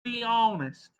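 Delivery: put-on high, pushy Southern accent. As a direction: press the question in a Southern accent. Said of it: "I can do a Southern accent".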